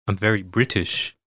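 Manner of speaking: lightly put-on British accent; playful hint of a posh British voice; mock British accent. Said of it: "Kant feels, like, British".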